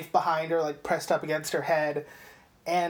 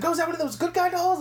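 much higher, fast gushing cop; excited cop voice; 10 semitones higher, faster than usual. Retold or imitated. The cop gushing over the doll, higher and fast. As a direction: rattle it off like a thrilled fan.

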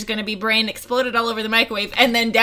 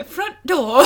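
mock British pronunciation of "door"; put-on British "front door"; British accent; 5 semitones higher, 4 dB louder than usual. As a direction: say it as a playful British imitation. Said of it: "kinda British is when they say door".

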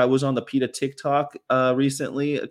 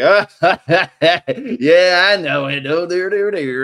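loud, high mock guffaw into words; imitated laughing cops; 7 semitones higher, 8 dB louder than usual. Officers reacting to the driver, loud imitated laughter. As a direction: laugh it out big, like amused officers.

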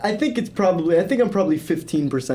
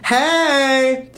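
eager greeting; 6 semitones higher than usual. Pretend. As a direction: greet him with big energy. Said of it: "Energy not matched".

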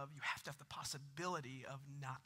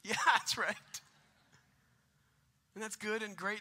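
normal talking voice

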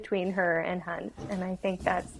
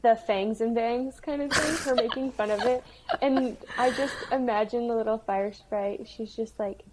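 usual speaking voice

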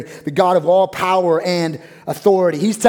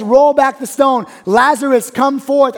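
commanding call; 8 semitones higher, 7 dB louder than usual. Acted. Jesus calling Lazarus from the grave.

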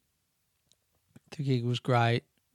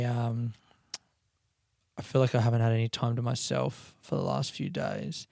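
usual speaking voice

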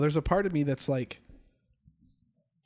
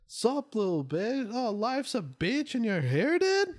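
quoting a sketch; 8 semitones higher, slower than usual. Retold or imitated. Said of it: "I think I shared the".

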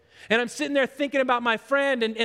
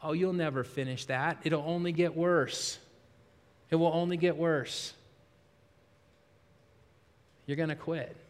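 discouraging inner voice; 6 semitones lower, 6 dB quieter than usual. Acted. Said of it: "That's the voice we hear all the time".

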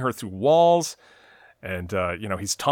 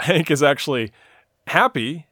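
ordinary talk

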